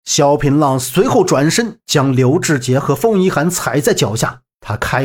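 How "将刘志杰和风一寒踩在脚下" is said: plain reading voice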